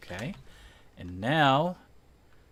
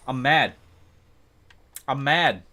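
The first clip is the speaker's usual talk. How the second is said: mock mad; 4 semitones higher, 10 dB louder than usual. Real, not acted.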